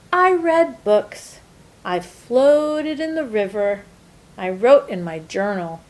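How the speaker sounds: ordinary delivery, nothing marked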